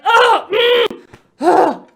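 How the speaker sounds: loud, high frustrated growling "ugh"; angry groan. Real, not acted. Reader fuming at the chapter, angry groans. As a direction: groan out pure exasperation. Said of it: "made me so freaking angry".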